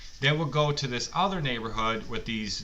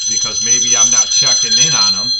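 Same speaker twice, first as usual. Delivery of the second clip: suddenly much louder, hammering the point; retold loud outburst; 16 dB louder than usual. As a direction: burst out loud as the buddy making his point. Buddy insists it's on him.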